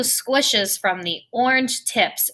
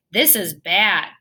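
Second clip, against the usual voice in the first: ordinary delivery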